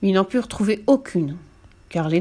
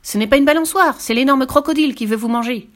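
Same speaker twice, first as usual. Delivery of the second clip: much higher, louder yell; monkey's shout; 7 semitones higher, 5 dB louder than usual. A narrator's reading. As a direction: shoo the children away fast. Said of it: "hurla-t-il aux enfants". (le singe crie aux enfants de s'enfuir)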